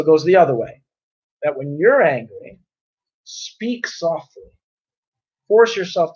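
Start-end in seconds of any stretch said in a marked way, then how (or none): 3.2-4.4 slow and hushed, a little higher; gently softened, drawn-out instruction; speaking softly. Shown as a demonstration. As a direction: ease off the volume and stretch it out. Models the soft speech he advises.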